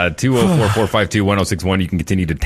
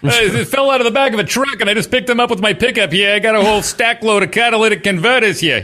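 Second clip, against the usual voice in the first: high, sly crook voice; shady seller voice; 12 semitones higher than usual. Pretend. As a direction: play a dodgy scrap dealer offloading goods. Host acts a shady seller.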